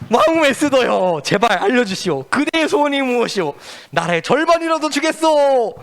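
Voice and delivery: eager king asking. Acted. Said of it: "진짜 궁금해가지고 물어봤어요".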